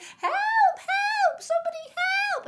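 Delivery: very high, very loud shrieks; acted storybook scream of panic; screaming for help. A narrator's reading. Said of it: "she screamed and she screamed".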